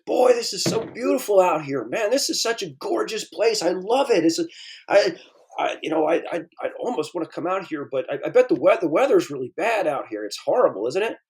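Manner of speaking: high, bright-eyed gushing tourist voice; gushing California tourist. Retold or imitated. California visitor gushing to the bartender, high excited voice. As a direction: gush about the scenery like an awestruck visitor. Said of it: "he says".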